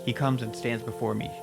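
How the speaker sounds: usual speaking voice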